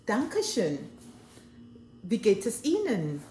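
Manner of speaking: German "Dankeschön" and "Wie geht es Ihnen?" said with German sounds; German phrases. Shown as a demonstration. Showing the audience German greetings, in German. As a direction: speak these in German. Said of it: "in German".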